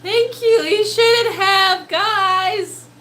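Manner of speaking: high, loud, drawn-out gratitude; overwhelmed thanks. Real, not acted. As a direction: gush thanks, overcome by a huge surprise. Overwhelmed thanks for big gift.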